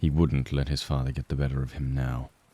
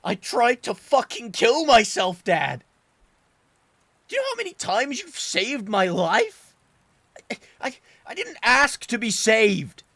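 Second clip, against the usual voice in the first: much higher and louder, crying as he shouts; tears pouring out through the yelling; sobbing outburst; 4 dB louder than usual. Acted. Son screaming his guilt at his father, sobbing. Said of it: "sobbing the little of what remained".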